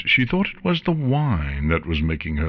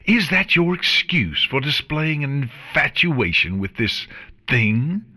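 scornful yell; 6 semitones higher than usual. Acted. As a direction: yell the accusation with contempt. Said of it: "the image's voice yelled".

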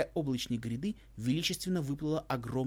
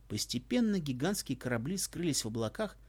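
usual speaking voice

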